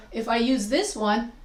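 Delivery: ordinary delivery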